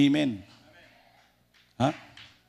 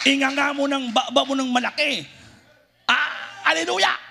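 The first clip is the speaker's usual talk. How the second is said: shouted praise; 11 semitones higher than usual. Shown as a demonstration. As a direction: shout it out to show them how. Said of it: "at sumigaw".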